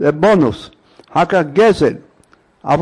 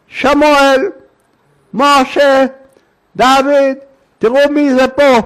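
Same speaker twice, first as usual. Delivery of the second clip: higher, louder mock call to the house; imitated calling out; 6 semitones higher, 3 dB louder, slower than usual. Woman fakes a houseful of sons to scare the thief, mock yell. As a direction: call out as if sons were home. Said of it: "צועקת".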